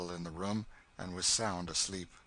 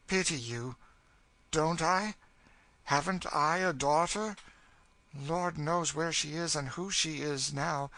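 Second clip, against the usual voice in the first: higher, forceful lament; anguished, bitter woman; 7 semitones higher, 4 dB louder than usual. Acted. Cassie lamenting her lost daughter, anguished bitterness. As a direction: retort bitterly, rising in intensity.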